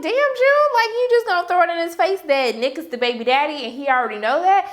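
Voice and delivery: high, loud scolding disbelief; incredulous reaction. Retold or imitated. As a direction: call her out in high-pitched shock.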